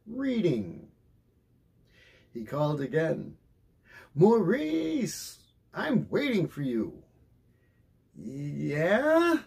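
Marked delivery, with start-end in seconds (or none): 4.1-7.0 calling out. Acted. Father calling son from outside. 8.1-9.4 sleepy drawl. Acted. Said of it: "drawled a sleepy voice".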